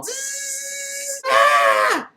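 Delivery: high buzz then loud yelp; buzzing sphere sound. Retold or imitated. Flying death sphere zipping at the boy, buzz and yelp.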